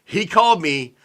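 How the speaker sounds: usual speaking voice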